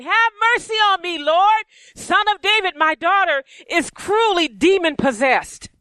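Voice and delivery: high, pleading cry for mercy. A narrator's reading. Canaanite mother begging the Lord for her daughter, crying out. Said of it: "began to cry out".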